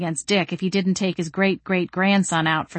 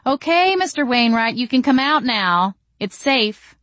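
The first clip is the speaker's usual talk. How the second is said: calling out higher, only a touch louder; understated narrated yell; mild yell; 6 semitones higher, 3 dB louder than usual. A narrator's reading. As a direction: call to someone hiding, easing off at the end. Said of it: "I yelled".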